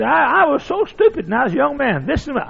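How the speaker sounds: put-on high, stammering old man; squeaky old voice. Old preacher confessing youthful mistakes, squeaky. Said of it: "In a squeaky old voice".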